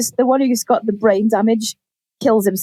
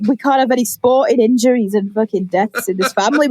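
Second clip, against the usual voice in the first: usual speaking voice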